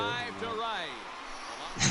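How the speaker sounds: amused chuckle to self; light laugh mid-story. Real, not acted. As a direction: chuckle while telling a story. Amused while retelling film scene.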